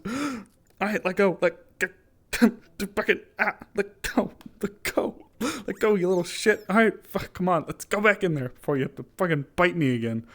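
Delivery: high, loud let go; pry a biting pet off; loud pained scolding. Real, not acted. Pet still biting her hand.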